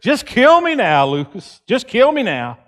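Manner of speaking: hammed-up fed-up complaint; tossed-off dramatic plea to die; exasperated groan. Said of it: "You ever hear people say".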